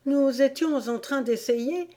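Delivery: high, meek explanation; most humble. A narrator's reading. Gardener explains himself to the queen, very humbly. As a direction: explain yourself humbly to royalty. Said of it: "du ton le plus humble".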